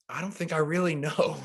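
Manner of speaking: high, sheepish voice, caught out; sheepish, embarrassed voice; sheepish admission. Retold or imitated. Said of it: "And I'm like".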